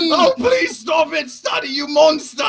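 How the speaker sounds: high, screamed comic pleading; mock-hysterical shout. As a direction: cry out in exaggerated outrage. Continuing the hysterical mock cast.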